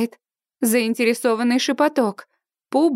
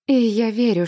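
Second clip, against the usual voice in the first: usual speaking voice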